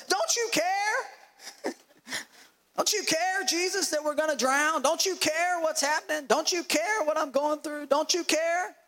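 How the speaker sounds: high-pitched desperate acted plea; frantic high yelling for help; shouting. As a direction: cry out in panic, pitch held high throughout.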